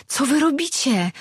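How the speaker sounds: high, desperate plea; frightened girl crying out to her captors. A narrator's reading. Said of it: "wołała zrozpaczona Imi".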